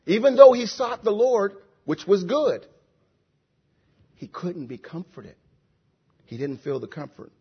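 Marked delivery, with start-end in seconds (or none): 4.2-7.3 very soft, low-pitched aside; hushed sorrow. Real, not acted. Preacher telling of the comfortless psalmist, hushed and low. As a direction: drop right down, almost to nothing.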